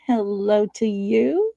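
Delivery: ordinary talk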